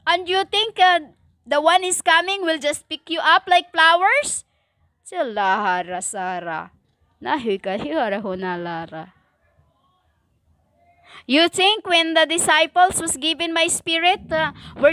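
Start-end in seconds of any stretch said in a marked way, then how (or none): 5.0-9.1 lower, softer murmured tongues; speaking in tongues. Real, not acted. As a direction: slip into a quick prayer language. Sermon breaks into tongues.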